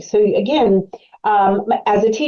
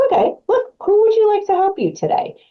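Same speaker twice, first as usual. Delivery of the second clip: much higher, upbeat coaxing voice; chirpy higher voice to win the child over; bright coaxing teacher; 13 semitones higher than usual. Acted. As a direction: coax the kid brightly, way up high.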